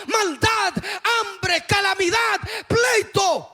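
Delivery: climbing sermon shout; fiery preaching. Acted. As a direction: preach it out to the whole church at full force. Preacher lists calamities at full force.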